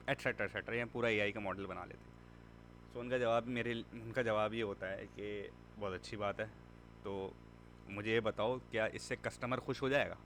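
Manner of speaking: ordinary talk, nothing marked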